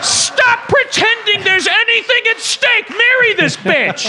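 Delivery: high, yelled rant; exasperated shout. Real, not acted. Host ranting at the movie hero, exasperated shout. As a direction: vent at the screen.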